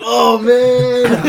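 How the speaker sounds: loud, drawn-out stoner moan; stoned voice. Retold or imitated. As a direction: imitate a stoned guy groaning. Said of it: "Because you're like".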